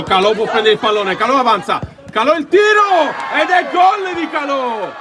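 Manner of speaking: building to a high, loud goal shout; rising goal call. Real, not acted. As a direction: let the voice climb to GOL. Goal build-up, live commentary.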